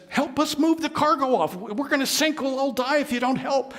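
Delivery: high, pressing plea; urgent captain. Acted. Captain begging Jonah to help in the storm, high and urgent. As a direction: hurry him, the ship is sinking.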